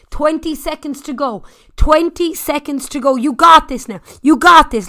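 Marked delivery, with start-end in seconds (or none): 3.2-4.9 loud encouragement. Real, not acted. Coach encouraging riders.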